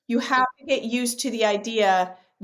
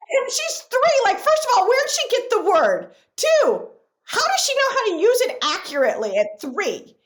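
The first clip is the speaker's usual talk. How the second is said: disbelief; 11 semitones higher, 3 dB louder than usual. Real, not acted. Laughing incredulous retelling.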